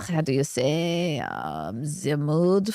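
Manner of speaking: low, halting mock French accent; badly faked French accent, searching for words; hammed-up French, dropping low and slow; attempted French accent. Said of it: "cannot do a French accent".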